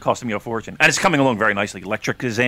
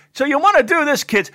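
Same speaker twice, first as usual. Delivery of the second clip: much higher, louder teacher voice, nearly shouting the warning; Mister Tomarcio imitation; 4 dB louder than usual. Chemistry teacher warning kids about the Bunsen burner, in a high loud voice. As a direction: bellow a safety warning like a wound-up chemistry teacher. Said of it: "And he would talk just like that".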